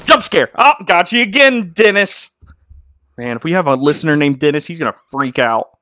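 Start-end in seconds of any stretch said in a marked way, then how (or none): 0.1-2.3 sudden high, loud burst; playful shout. Pretend. Host springing a jump scare on Dennis, playful shout. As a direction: pounce on the listener with a mock scare.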